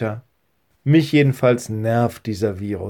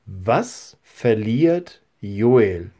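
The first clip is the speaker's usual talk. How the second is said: slow question; much slower than usual. Shown as a demonstration. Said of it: "Die zweite Frage ist".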